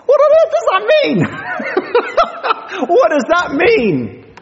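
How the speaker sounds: squealing with amused bafflement; incredulous laugh. Real, not acted. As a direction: laugh out at the silly lyric. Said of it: "What a load of old nonsense".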